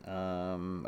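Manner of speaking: usual speaking voice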